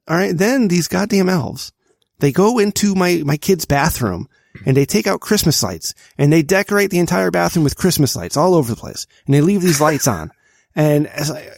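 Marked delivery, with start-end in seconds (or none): none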